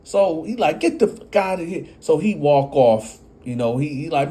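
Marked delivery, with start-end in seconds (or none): 0.8-1.9 belligerent. Retold or imitated.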